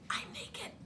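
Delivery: mock mortified whisper; embarrassed whisper. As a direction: whisper it like a shameful secret.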